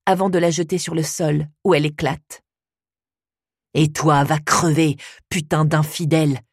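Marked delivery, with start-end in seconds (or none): 3.7-6.4 put-on drunk slurring. A narrator's reading. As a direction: slur it like a drunk man. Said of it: "jure mon père, articulant avec difficulté".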